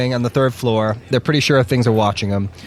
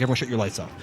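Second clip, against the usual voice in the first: soft low order; softer hushed command; commander's order; 3 semitones lower, 7 dB quieter, much faster than usual. Retold or imitated. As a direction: order them quietly.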